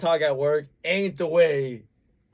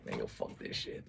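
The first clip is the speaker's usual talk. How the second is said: whispered private griping; hushed, voiceless aside to yourself; muttering to oneself; 16 dB quieter than usual. Acted. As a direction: mutter it very low, as if alone. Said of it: "when you're by yourself".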